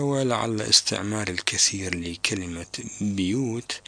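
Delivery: usual speaking voice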